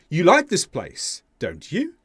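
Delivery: "don't you" with "you" going up; really asking, lift the final "you"; rise on YOU. Shown as a demonstration. Teacher replays the first example as genuine question, "you" rising. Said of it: "the intonation went up on you".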